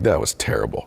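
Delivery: soft whispered self-reproach; whispered regret. Retold or imitated. Dad whispering to himself after scolding his son. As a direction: breathe it out as a private admission.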